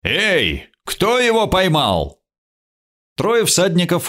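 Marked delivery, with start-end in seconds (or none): 0.0-2.2 higher-pitched demand; raised, commanding question; calling out. A narrator's reading. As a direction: call the question out to the riders. Lord calls out to riders.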